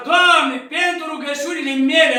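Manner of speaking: strigat tare și sus, cu încredere; „mele” rostit apăsat și sus; rugă tare. Shown as a demonstration. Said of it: "îl învăța Sfântul Antonie". (rugă strigată, sigură)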